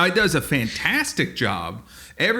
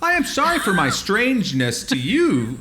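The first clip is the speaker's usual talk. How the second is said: high-pitched robot apology; formal little robot voice; apologetic robot; 7 semitones higher than usual. Acted. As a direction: play a polite robot, pitched up. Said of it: "A robot that goes".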